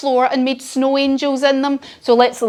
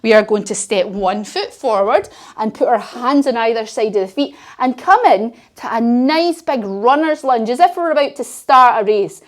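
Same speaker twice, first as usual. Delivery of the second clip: usual speaking voice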